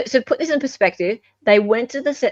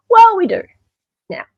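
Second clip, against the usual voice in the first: high, loud gleeful reveal; spring the news with delight; excited reveal; 11 semitones higher, 8 dB louder than usual. Real, not acted. Host revealing the videos, excited and loud.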